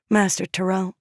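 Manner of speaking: ordinary delivery, nothing marked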